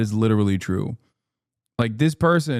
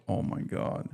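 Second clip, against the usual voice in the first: whispered; 13 dB quieter than usual. Real, not acted. Exasperated reaction to chat take.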